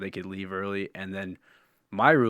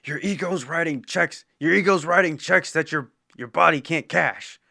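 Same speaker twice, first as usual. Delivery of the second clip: higher, louder stern commander; Top Gun line; 8 semitones higher, 6 dB louder than usual. Retold or imitated. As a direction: scold a cocky pilot like a commander. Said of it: "But how about".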